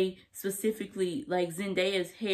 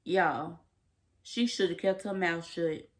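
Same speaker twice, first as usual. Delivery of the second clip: ordinary talk, nothing marked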